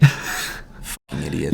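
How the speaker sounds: low slow mutter under breath; muttered aside. Real, not acted. Muttered aside about show-offs.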